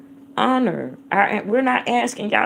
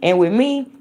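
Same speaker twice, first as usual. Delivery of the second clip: usual speaking voice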